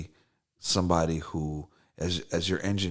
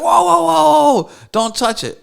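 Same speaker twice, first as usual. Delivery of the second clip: alarmed warning; 14 dB louder than usual. Retold or imitated. Imitating the guitar's owner stopping him, alarmed shout. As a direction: cry out to stop someone grabbing it.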